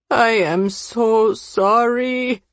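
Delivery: slow, drawn-out anguished apology; acted tearful plea, stretching each word; crying apology. A narrator's reading. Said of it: "he cries".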